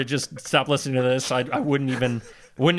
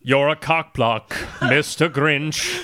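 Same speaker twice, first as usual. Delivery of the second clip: slow, drawn-out Grinch growl; mock Grinch voice; much slower than usual. Podcast host voices the Grinch for laughs. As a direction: ham up the Grinch, slowly.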